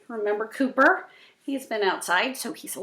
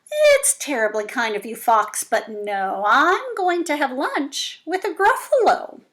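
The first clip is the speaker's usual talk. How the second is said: louder and higher, polite but bold; clever mouse; 3 semitones higher, 5 dB louder than usual. Acted. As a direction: brush off the fox with a confident fib. Mouse voice in read-aloud.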